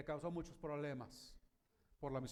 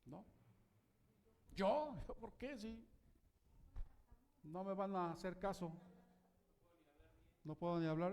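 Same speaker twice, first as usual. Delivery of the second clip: Moses making excuses; 3 semitones higher than usual. Acted. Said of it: "una mentirota, ¿verdad?".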